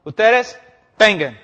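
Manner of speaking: loud barked order to come forward; soldier's command. Retold or imitated. Soldado romano da órdenes. (orden gritada de soldado)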